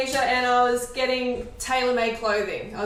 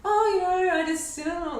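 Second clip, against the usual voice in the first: awkward politeness; 5 semitones higher than usual. Retold or imitated. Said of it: "on the outside I'm like".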